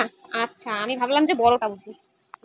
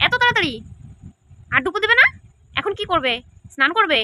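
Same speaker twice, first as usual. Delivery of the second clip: ordinary delivery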